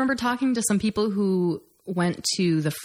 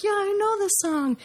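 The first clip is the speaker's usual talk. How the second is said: high, put-on fan voice; high-pitched acted boast; mock eager fan. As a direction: pitch it way up, playing a fan who knows the song. Acting out being a knowing fan.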